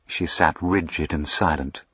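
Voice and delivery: usual speaking voice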